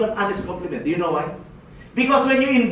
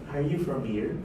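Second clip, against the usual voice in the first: low, hushed, ingratiating question; soft friendly opener; 7 semitones lower, 9 dB quieter than usual. Acted. He asks the apologetic shopper, softly friendly.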